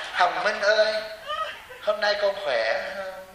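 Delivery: slow, sweet, tender greeting; drawn-out kindly greeting to a disciple; imitating a gentle monk. Imitating a soft monk greeting Hồng Minh, slow and sweet. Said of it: "bà theo thầy Pháp Hòa là".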